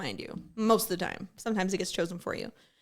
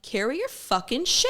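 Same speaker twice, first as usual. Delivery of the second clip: higher and louder, biting retort; retold snap; 6 semitones higher, 5 dB louder than usual. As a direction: bark it back at her. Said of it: "And I'm like".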